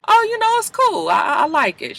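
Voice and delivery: high-pitched breezy dodge; put-on "it's fine" voice; feigned casual. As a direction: brush it off in a high, light voice. Acted evasive answer to coworker.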